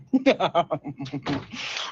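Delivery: low rolling chuckle. Real, not acted. Host tasting a treat on air, chuckling. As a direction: chuckle in a long, trailing run. Laughs while eating the cookie.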